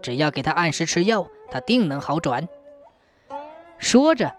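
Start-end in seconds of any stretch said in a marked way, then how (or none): 0.0-2.5 low, soft reassurance; comforting. A narrator's reading. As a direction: soothe her gently, quieter and lower. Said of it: "马长脸安慰道".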